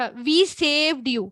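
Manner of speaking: we saved you said very slowly; slow translation. Shown as a demonstration. Teacher translates the verb for students, very slow. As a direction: draw out we saved you word by word. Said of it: "نَجَّيْنَاكُمْ".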